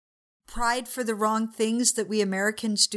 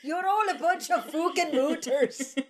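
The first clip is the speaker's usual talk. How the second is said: higher, giggly fake German; laughing through a put-on German accent; mock German accent; 5 semitones higher than usual. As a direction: hurl a silly insult in pretend German. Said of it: "I'm going to be German".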